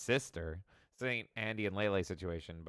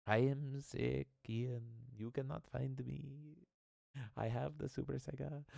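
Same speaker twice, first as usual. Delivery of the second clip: quiet, slow dreamy sing-song; soft peaceful sick voice; 4 semitones higher, 8 dB quieter, slower than usual. Pretend. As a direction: drift it out softly like a distant song. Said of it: "softly hear Alejandro singing ... very peacefully".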